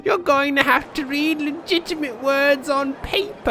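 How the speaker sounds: high-pitched cartoon villain voice; gloating tyrant. Mayor restricting the townspeople's electronic access, gloating tyrant. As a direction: lord it over them in a high, hammed-up tyrant voice. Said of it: "being the tyrant that I am".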